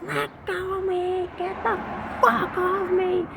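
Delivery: high shrieking mock girl voice; screaming girl. Pinned girl shrieking at narrator, high mock fury. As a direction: shriek like a furious kid pinned down. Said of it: "she's like".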